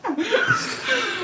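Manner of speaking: very high cackling laugh; cackling hyena. Acted. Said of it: "like a cackling hyena".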